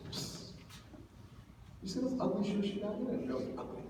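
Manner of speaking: soft secretive whisper about a classmate; gossiping whisper. Retold or imitated. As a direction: whisper the gossip behind her back. Schoolgirl gossiping about another girl.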